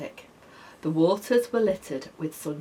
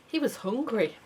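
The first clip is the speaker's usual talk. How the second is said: plain reading voice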